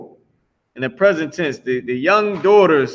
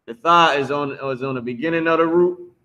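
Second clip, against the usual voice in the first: ordinary delivery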